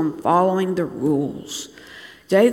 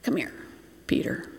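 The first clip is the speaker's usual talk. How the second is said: hushed, confidential summons; soft, secretive near-whisper; furtive aside; 5 semitones lower, 10 dB quieter than usual. Acted. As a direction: pull someone aside under your breath. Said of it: "the Pharisees pull them aside".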